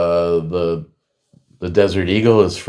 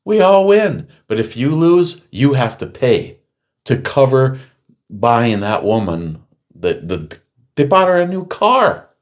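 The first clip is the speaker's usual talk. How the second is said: mocking the insurer; 6 semitones higher than usual. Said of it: "And he's like".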